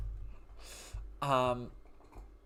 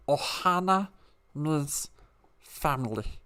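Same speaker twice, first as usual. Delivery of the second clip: Stitch voice; slower than usual. Retold or imitated. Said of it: "I can do Stitch".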